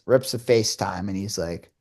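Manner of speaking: ordinary talk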